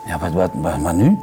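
low, soft and at a loss; hesitant, softly sighed question; sighing. A narrator's reading. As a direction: sigh it out helplessly, dropping your voice. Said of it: "zuchtte de vrouw".

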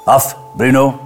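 firm, barked order to a dog; barked command. A narrator's reading. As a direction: command the dog like its stern master. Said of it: "blafte de man". (kortaf geblaft bevel)

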